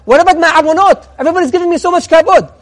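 high, loud rhetorical protest; acting out a worried rabbi's outcry; impassioned plea. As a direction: plead your case with rising urgency. Acts the rabbi's anguished plea.

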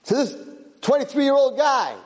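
master calling out the match; mock-grand offer; high-pitched announcement. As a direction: proclaim it high and exclaimed.

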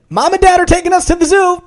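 very high, loud excited call; excited girl. Acted. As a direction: burst in squealing the news. Excited sister voiced.

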